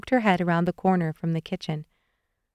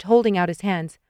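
ordinary delivery

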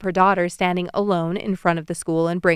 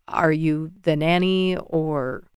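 slower, lower man's voice; a hint of a gruff neighbor asking; lightly voiced low man; 4 semitones lower, slower than usual. A narrator's reading. Stranger questioning the mother, low and slow.

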